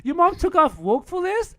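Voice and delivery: high-pitched incredulous scolding; mock outrage. Friend teasing Noah for backing out, mock outraged.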